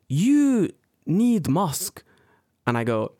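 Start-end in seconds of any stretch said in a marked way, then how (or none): 0.1-2.0 very slow, high-pitched instruction; drawn-out, high mock scolding; old woman. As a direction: imitate an old lady spelling it out. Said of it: "And she goes".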